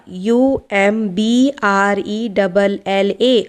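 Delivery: each letter said slowly and apart; slow spelling out. Shown as a demonstration. Teacher spelling a word for students, slow and spaced. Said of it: "teacher spells word letter by letter".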